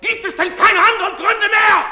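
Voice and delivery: high, loud shout with a harsh laugh; bitter shouting. Acted. Father snaps back at his wife's reproach, bitter laughing shout.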